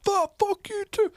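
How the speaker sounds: very high, loud screech back; mock shrieked retort, drawn out; imitated yelling girl. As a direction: mimic a girl screaming the insult back. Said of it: "She's like".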